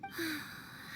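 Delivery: long soft sigh, almost breathed out; weary sigh. Acted. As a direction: let out a tired, mournful sigh. Princess laments her lost land.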